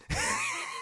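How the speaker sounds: squealing real laugh; high laugh burst. Real, not acted. Streamer cracks up at the scene, high squealing laugh.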